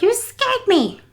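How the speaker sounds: high startled yelp; a jumpy old lady jolted awake; startled old woman. Retold or imitated. Caregiver retells the old woman being woken. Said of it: "she jumps out of bed".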